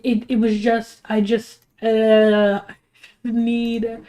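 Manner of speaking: ordinary talk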